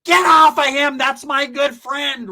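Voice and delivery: high-pitched loud defending shout; mock outraged yell. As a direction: yell it high, stretching the words out.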